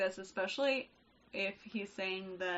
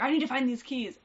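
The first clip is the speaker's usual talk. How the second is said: loud, higher mock-frantic dad; imitated yelling; 4 semitones higher, 8 dB louder than usual. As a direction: act out a dad yelling for his keys. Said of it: "yelling 'cause my dad was like".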